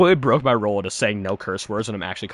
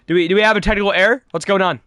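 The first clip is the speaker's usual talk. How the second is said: confused alarm; 6 semitones higher, 4 dB louder, faster than usual. Real, not acted. Confused about technical error.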